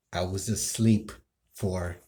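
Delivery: normal talking voice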